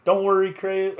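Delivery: high, loud mock Southern accent. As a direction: call it out in an imitated Southern accent. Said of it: "southern accent".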